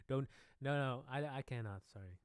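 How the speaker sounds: normal talking voice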